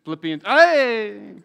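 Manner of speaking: loud, slow celebratory hey; triumphant hey. Real, not acted. Preacher cheering when the verse slide finally appears, loud slow cheer. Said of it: "fourth time's a charm".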